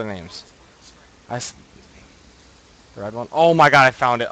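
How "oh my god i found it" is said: startled excitement. Real, not acted. Finds item in scary cave.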